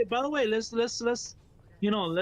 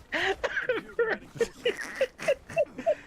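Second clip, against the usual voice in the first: squealing laugh that climbs much higher. Real, not acted. Host cracking up retelling the stream moment, high squealing laugh.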